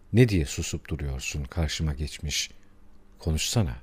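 kısık, pes sesle sıkıştırma; içinden söyler gibi alçak sesle üstele; içten çıkışma. A narrator's reading. Susan konuğa içinden sessizce çıkışma. (alçak sesle içten çıkışma)

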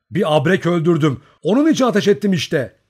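plain reading voice

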